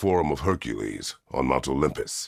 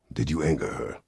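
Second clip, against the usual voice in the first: ordinary delivery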